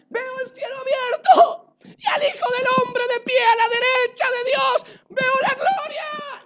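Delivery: high, loud, tearful cry of a man seeing heaven; dying martyr's cry. Acted. Dying Stephen to his killers, a loud weeping cry. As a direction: cry it out through tears as the stones fall. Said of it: "exclamó".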